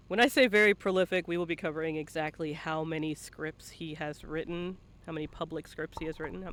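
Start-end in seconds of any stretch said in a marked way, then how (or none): none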